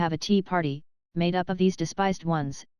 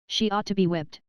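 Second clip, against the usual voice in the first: ordinary delivery, nothing marked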